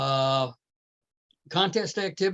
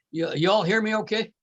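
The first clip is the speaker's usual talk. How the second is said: usual speaking voice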